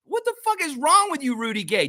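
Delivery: high, yelling in outrage; angry outburst; exasperated shout. Real, not acted. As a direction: blow up at him. Angry rant at player.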